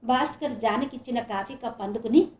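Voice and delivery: ordinary delivery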